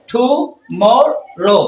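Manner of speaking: slow "tomorrow" in three separate parts; teacher's slow syllable demo. Shown as a demonstration. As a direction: pause between each syllable of "tomorrow". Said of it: "কয়টা syllable?".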